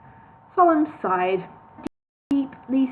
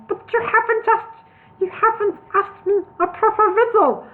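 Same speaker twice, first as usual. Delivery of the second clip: Solomon protesting; 11 semitones higher, 4 dB louder than usual. A narrator's reading.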